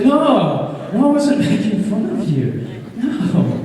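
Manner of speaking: high, mock-mortified denial; retold flustered protest. Actor apologising to a little person he offended, flustered denial.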